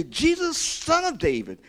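high, loud call to Jesus; crying out. Acted. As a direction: call out like a blind beggar pleading. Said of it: "began to cry out and say".

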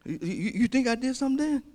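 high, quick challenge; worked-up, daring question; defiant retort. Acted. Acting blind man defying the crowd.

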